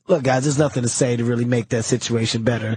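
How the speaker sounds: deep, calm, low mock-solemn voice; imitating Patrice.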